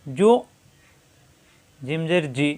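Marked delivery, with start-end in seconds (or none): 0.1-0.3 জু held in the throat, no bounce; hard stop. Shown as a demonstration. Teacher showing জু caught in the throat. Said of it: "গলার ভিতরে আটকে যাবে".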